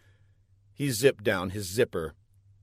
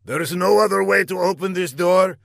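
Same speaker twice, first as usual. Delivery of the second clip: raised, louder villain voice; irritated villain; 8 semitones higher, 6 dB louder, faster than usual. A narrator's reading. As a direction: demand an answer as the villain boss. Villain questions scientist.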